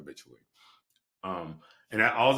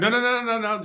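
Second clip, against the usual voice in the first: much higher, louder frantic protest; 11 dB louder than usual. Retold or imitated. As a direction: stop him before he takes it back. Rushing to accept the role.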